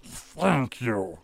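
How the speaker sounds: halting monster voice with new vocal cords; newborn flesh creature. Acted. Flesh homunculus to its maker, creature voice. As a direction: speak as a creature trying out its voice. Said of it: "a much fresher, uh, vocal cords".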